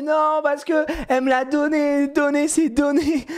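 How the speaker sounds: put-on slow, high child whine; put-on drawn-out protest; insistent little girl. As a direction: imitate a kid wailing the rule. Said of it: "elle se met à crier".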